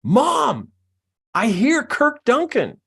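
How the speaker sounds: put-on high, loud kid calling to mom; kid's yell. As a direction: yell out like an excited child in a car. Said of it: "yells out".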